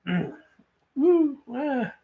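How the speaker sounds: squealing while tickled; being tickled. Real, not acted. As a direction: yelp from a tickle. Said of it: "Stop tickling me".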